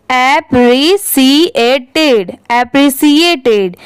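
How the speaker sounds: appreciated spoken very slowly, part by part; slow syllable by syllable. Shown as a demonstration. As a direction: break appreciated into slow pieces. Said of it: "इसे आप ऐसे पढ़ सकते हैं".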